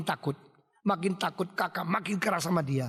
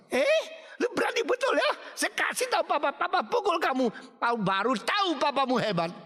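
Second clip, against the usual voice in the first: high-pitched angry scolding; acted, high and worked-up; bullying threat; 10 semitones higher than usual. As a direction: lash out at a defiant younger sibling. Brother threatens defiant younger sibling.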